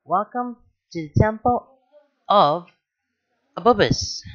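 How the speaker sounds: high, hammed-up grand announcement; mock a temple guide's big welcome; Pidge's teasing tradition, mock-dramatic; mockingly dramatic. A narrator's reading. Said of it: "in a voice that's nasally and mockingly dramatic".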